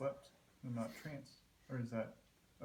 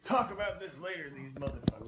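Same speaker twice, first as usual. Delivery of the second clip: mock loud outburst; 3 semitones higher, 5 dB louder than usual. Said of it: "Not like".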